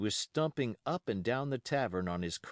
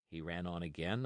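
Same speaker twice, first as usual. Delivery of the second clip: usual speaking voice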